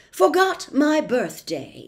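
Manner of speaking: loud, slowish officious policeman; pompous policeman. Acted. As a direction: lay down the law with bluster. Policeman writes note.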